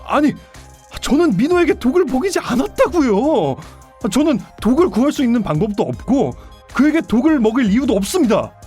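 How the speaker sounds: exasperated protest of innocence; vehement denial. Acted. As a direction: deny it heatedly, as one falsely accused. Suspect denies poisoning to interrogator.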